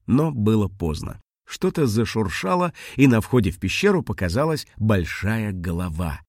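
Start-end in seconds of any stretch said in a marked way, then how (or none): none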